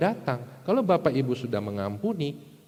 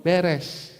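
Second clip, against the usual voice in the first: ordinary talk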